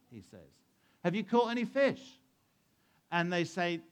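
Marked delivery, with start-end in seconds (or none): 1.0-2.2 high, raised call to a distant boat; calling across. Acted. Man on the shore questioning the fishermen, calling across. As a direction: call it over the water. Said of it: "That man shouts and calls across".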